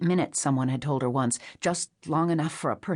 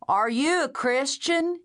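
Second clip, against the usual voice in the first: high, slow pleading question; plaintive stranger; 10 semitones higher, slower than usual. A narrator's reading. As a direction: ask like a lost child. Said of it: "The question held a plaintive note".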